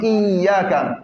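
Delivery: Iyaka slow, with the ya doubled. Shown as a demonstration. Teacher drills class, slowly, together. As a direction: draw out Iyaka, pressing the double ya. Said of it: "Ensemble, Iyaka".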